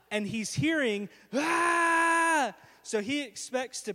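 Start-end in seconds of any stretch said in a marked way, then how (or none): 1.3-2.5 high, loud scream; retold scream. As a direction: cry out while falling. Hearing the scream from below.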